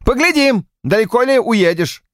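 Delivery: high, fervent preaching voice; heated patriotic rant. A narrator's reading. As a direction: hammer home each point. Polward patriotic harangue.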